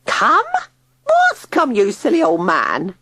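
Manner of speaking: very high, loud screech; shrill scolding. A narrator's reading. Tilly screeching at frightened Digby. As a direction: shriek it at the silly old man.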